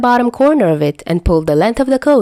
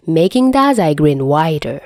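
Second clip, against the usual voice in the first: ordinary delivery, nothing marked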